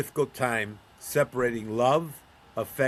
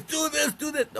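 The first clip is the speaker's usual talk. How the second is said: mock sideline yelling; 11 semitones higher than usual. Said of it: "we're yelling at the boys".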